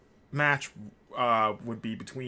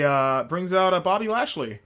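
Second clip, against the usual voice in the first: ordinary talk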